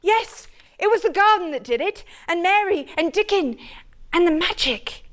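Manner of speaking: put-on high, eager tumbling boy's voice; healthy boy. To his father, a flushed boy tumbles out his story in a high voice. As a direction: let the words tumble over one another like an excited boy. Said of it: "He said it all so like a healthy boy".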